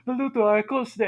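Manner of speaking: high, loud crowd holler; drunken shout. Acted. Reviewer quoting drunken Italians in the audience, high shout. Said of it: "save for the drunken few shouting".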